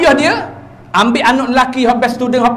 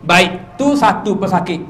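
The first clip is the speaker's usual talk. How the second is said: ordinary delivery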